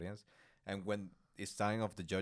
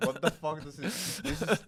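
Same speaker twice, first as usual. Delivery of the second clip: laughing; 8 semitones higher, 7 dB louder than usual. Real, not acted. Laughing while imitating judge.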